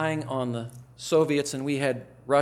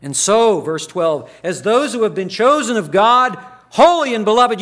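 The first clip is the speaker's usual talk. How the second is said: full-force preaching; 10 semitones higher, 13 dB louder than usual. Acted. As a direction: preach it out loud and high, in full fervour. Preaching verse twelve.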